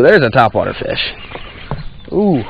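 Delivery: ordinary delivery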